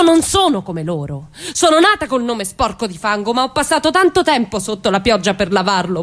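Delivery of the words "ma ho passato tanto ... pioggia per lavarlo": ordinary talk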